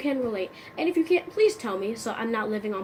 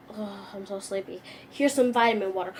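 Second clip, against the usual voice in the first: usual speaking voice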